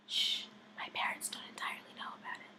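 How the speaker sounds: hushed, hurried stage whisper; shush, then quick breathy whisper; secretive whisper. Acted.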